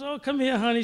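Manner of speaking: sweet mom. Retold or imitated. Mother greeting her son after the game, sweetly. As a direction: coo like a doting mom. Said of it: "She goes".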